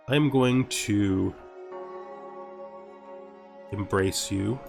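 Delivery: ordinary talk, nothing marked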